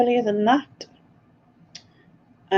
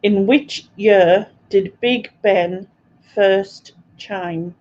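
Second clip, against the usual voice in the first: slow and measured, pausing between word groups; deliberate question reading; slower than usual. Real, not acted. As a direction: repeat the quiz question slowly and clearly. Quizmaster repeats the question.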